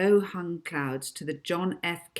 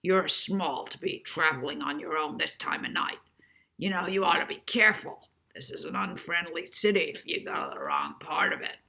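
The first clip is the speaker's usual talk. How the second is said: husky. A narrator's reading. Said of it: "said huskily".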